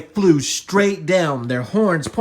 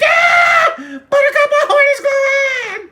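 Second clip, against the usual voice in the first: very high, loud squealing panic; excited scream; 10 dB louder than usual. Acted. Yodi telling Buttercup about horn, high shriek. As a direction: shriek it in cartoon alarm.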